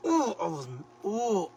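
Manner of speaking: high, loud squealing outrage; mock outrage. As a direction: relive the fury, squealing. Retelling anger at broken shelf.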